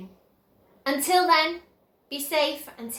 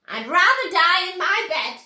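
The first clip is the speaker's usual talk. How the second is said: stubborn shout; 5 semitones higher, 7 dB louder than usual. A narrator's reading. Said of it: "shouted Grandma Josephine".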